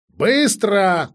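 loud, high order; urgent shouted order; commanding. A narrator's reading. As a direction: call it out as a sharp command. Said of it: "командовала меж тем чародейка".

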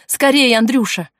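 high, loud hurrying cry; urgent call. Acted. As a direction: call out to hurry him along. Girl urging boy to hurry.

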